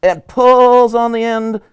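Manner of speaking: loud, high, drawn-out "pulls"; straining pull. A narrator's reading. Rabbit joins the line to pull, loud straining. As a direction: heave on the word, long and loud.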